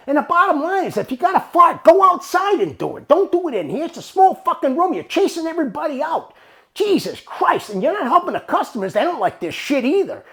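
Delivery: loud, high-pitched acted rant; fed-up boss reading the riot act; angry telling-off. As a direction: lay into him at full volume.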